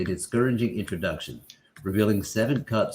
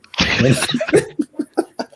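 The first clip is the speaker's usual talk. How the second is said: laughing at the troll's answer; high laugh; 6 dB louder than usual. Real, not acted. Laughing at the story.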